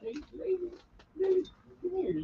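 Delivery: high, soft coaxing call to the dog. Real, not acted. Owner coaxing his little dog over, in high baby talk.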